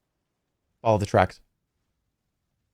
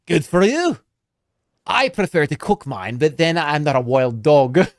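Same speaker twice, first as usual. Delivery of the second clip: bad Scottish accent; 3 semitones higher than usual. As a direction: tease the stranger in a hammed-up Scottish brogue. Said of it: "that's a bad Scottish accent".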